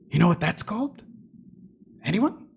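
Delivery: usual speaking voice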